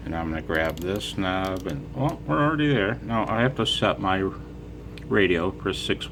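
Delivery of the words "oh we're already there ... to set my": usual speaking voice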